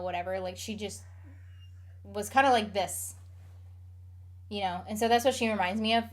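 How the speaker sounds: ordinary talk, nothing marked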